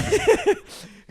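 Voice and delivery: high, loud laughing burst; sudden high cackle of real amusement. Real, not acted. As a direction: lose it laughing mid-sentence. Cracks up retelling the story.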